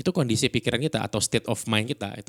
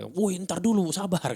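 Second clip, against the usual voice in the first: high-pitched agitated "hold on"; high, pushy mock yell; unpleasant peacemaker intonation; 11 semitones higher than usual. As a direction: imitate a bystander hollering for patience. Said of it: "Yang nengahin aja intonasinya nggak enak".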